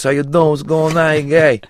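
high, loud New York guy impression; New York accent. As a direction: do a Brooklyn wiseguy greeting. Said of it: "talking like this".

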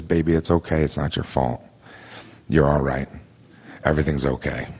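low, soft retold soothing; tender comforting. Partner holding the crying storyteller, tender low comfort. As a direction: imitate a partner consoling, softly and low. Partner holds him as he cries.

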